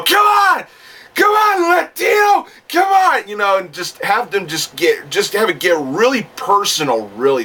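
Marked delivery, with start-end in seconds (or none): 0.0-3.3 high-pitched shouted goading; Brian Pillman taunting. Acted. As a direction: act out a wrestler daring his rival. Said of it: "I would have Pillman go".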